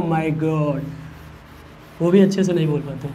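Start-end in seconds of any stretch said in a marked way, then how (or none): 0.0-0.8 low, soft mock American drawn-out "God"; imitated American accent. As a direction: mimic kids faking an American accent. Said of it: "American accent एक ही तरह की बात".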